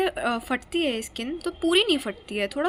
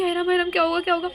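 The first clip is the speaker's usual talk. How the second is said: higher, louder worried fretting; nervous little panic replay; mock panicked fretting; 5 semitones higher, 5 dB louder, much faster than usual. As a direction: fret anxiously, retelling your panic. Acts her panic on table.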